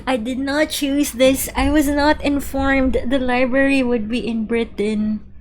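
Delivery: hammed-up indignant complaint; mock outrage. Mock protest at the setting.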